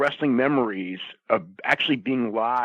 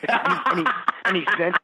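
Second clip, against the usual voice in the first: talking through laughter, a bit higher; laughing; 5 semitones higher, faster than usual. Real, not acted. Telling a friend the wrestling story, laughing. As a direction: try to carry on the story while laughing. Laughing after own impression.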